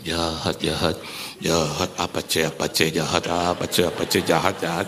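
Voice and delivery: low, chanting put-on voice; mock angel tallying sins. Angel judging churchgoers who skip Sunday, mock low droning. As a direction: drone it low and repetitive.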